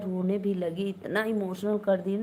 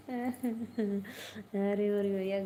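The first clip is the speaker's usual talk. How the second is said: ordinary talk, nothing marked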